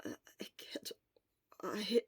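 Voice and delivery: weak breathy protest; barely voiced, exhausted; hoarse whisper. Sick Shura whispering.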